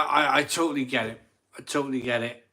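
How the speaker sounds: normal talking voice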